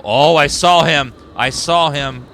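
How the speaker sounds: jolted by a glimpse of the killer; startled. Real, not acted. Player spotting the killer in the dark, startled.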